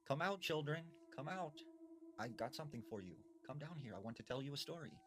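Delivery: lightly voiced sweet old woman calling the children down; a hint of a creepy grandma coaxing; luring old woman. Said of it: "this old woman started saying".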